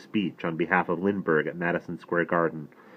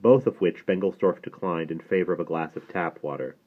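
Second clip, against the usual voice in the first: ordinary delivery, nothing marked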